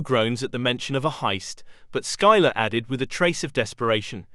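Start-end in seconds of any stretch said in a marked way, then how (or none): none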